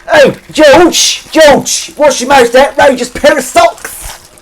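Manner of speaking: high, loud, slow shouted call up the stairs; shouting someone upstairs, drawn out; impression of Dan Fern shouting. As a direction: bellow his name like a wife calling from downstairs. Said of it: "That's my impression of Dan Fern shouting".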